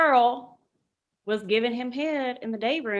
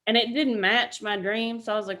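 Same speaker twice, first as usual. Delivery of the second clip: ordinary talk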